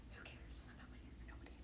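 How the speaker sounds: whispered aside to herself. Real, not acted. Whispering an aside to viewers about her hair. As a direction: mutter it under your breath.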